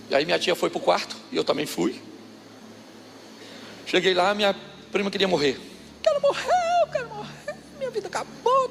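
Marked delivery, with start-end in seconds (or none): 6.0-8.7 put-on high, wailing teenage drama voice; whiny crying girl. Cousin wailing to her mother about dying. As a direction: wail it like a spoiled girl who wants to die.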